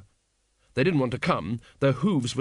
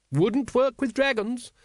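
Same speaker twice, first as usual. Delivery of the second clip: much higher, slow-paced lady's voice; brisk dragon expert; 11 semitones higher, slower than usual. A narrator's reading. Lady Ramkin correcting Vimes about dragons, high and emphatic.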